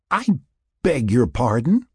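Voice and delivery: high-pitched tipsy indignation; mock offence. A narrator's reading. Sarah told by Mac she is drunk, mock offence. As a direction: ask it high, as if affronted.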